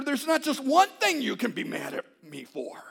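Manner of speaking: loud, high mock confession; a husband joking about his many faults, loud and high; joking quote. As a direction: blurt it out as a joke, high and loud. Comic self-mocking quote.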